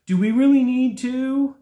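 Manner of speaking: high, pleading customer voice; whiny reluctant customer. Retold or imitated. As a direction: whine like a hesitant homeowner.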